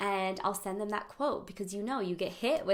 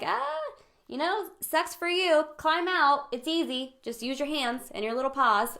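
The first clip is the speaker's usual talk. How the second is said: loud, high, dismissive pep talk; careless, singsong encouragement from above; breezy sympathy; 6 semitones higher, 6 dB louder than usual. Acted. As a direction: shrug off their trouble in a loud, high voice. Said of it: "'cause I was sympathy".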